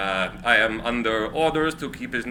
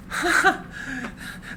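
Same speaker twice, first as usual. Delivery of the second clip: nervous laugh; 10 semitones higher, 5 dB quieter than usual. Acted. Tesla flustered by quick deal.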